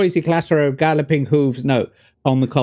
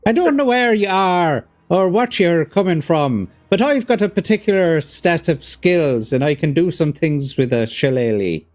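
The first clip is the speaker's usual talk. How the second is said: put-on Hollywood Irish accent; 4 semitones higher than usual. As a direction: parody a film threat in hammy Irish. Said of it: "I had to do the stereotypical Hollywood, uh, Irish accent".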